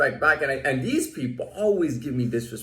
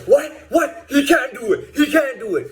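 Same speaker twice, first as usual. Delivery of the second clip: high, indignant mock guard voice; imitating the guard insisting the rule be broken; mock guard objecting; 11 semitones higher, 4 dB louder than usual.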